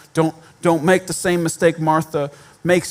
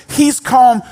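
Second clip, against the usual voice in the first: ordinary talk, nothing marked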